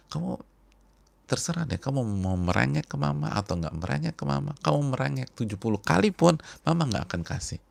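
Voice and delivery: firm mother. Retold or imitated. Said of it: "Terus kata ibunya".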